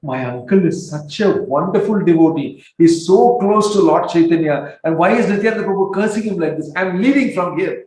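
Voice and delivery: high, loud indignant protest; indignant nephews. Retold or imitated. Nephews protesting their uncle's treatment. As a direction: storm off in outrage.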